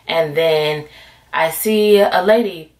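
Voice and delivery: normal talking voice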